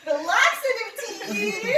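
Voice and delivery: high, loud, slow exclamation; excited punchline. Real, not acted. Telling the listener the punchline, high delighted shout. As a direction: land the reveal with glee.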